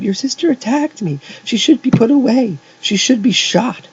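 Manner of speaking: very high-pitched, loud outburst; crying out. A narrator's reading. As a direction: cry out in shrieking outrage. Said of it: "Jeff cried".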